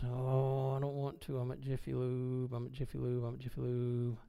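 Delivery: very soft, muttered mock protest; hushed, repetitive grumbling to God; reluctant whining. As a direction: whine it under your breath.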